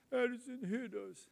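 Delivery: put-on slow, high childish tattle; tattling girls. Girls tattling to dad about Addison, childish voice. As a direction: voice the girls telling on their brother. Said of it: "the girls came and said".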